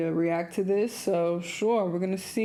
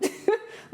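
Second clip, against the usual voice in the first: high airy laugh; short breathy laugh; 12 semitones higher, 3 dB quieter than usual. Real, not acted. Laughs at own retort.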